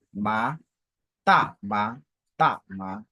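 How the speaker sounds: TA short, then MA rising from low; second-tone example. Shown as a demonstration. Teacher showing how the second tone reads, TA then rising MA. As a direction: say TA, then lift MA upward. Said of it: "comment on lit le deuxième ton".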